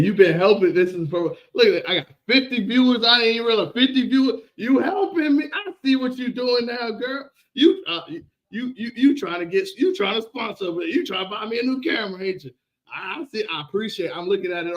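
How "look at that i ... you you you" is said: ordinary delivery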